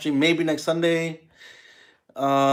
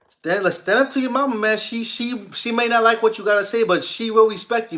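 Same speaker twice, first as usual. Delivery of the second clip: higher, emphatic pep talk; fired-up urging; 6 semitones higher than usual. Acted. Telling Anthony to stand up to mom, fired-up urging. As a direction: urge him on with passion.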